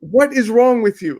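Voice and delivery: replaying how fed up she was, voice climbing; exasperated disbelief. Retold outburst at online critics.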